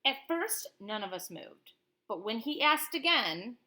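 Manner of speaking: lively read-aloud of a picture book; reading to a child. Acted. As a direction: tell it like a bedtime story to a child. Parent reads picture book aloud.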